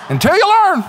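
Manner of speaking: high, loud mock preacher cry; exaggerated cry. Preacher pokes fun at own refrain, exaggerated high cry. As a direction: shoot the last word up high for comic effect.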